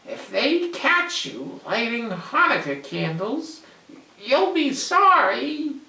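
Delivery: high goblin voice, stammering warning; a little goblin threatening bigger ones. Acted.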